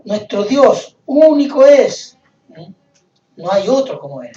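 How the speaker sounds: emphatic proclamation. Acted. Preacher declares one God to the congregation, emphatic proclamation.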